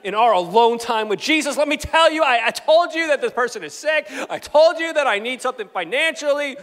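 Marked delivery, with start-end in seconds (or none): 1.6-6.6 loud, raised-pitch insisting; exaggerated pushy complaint; mock demanding prayer. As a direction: act out bossing Jesus around. Bossy prayer, telling Jesus off.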